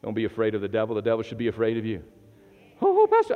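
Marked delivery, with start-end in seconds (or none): 2.8-3.3 high, mock-panicked plea to the pastor; mock frightened congregant. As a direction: squeak it like a scared church member. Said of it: "I've had people throughout my years".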